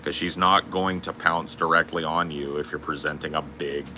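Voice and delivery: normal talking voice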